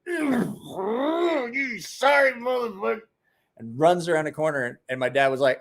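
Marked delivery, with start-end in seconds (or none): 0.1-3.0 retching curse. Retold or imitated. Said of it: "He's like".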